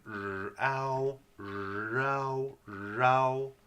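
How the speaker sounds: held "R" gliding up into a long "ow"; R vowel sound. Shown as a demonstration. Teacher blending the R vowel for learners, stretched. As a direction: repeat "r, ow", stretching each sound. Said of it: "There's that R vowel sound".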